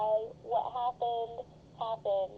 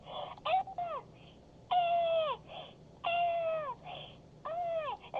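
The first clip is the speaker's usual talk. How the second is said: very high piglet-like squeals, one after another; imitated scream; much slower than usual. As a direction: mimic a high, drawn-out scream at moderate volume. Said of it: "This is how he screamed".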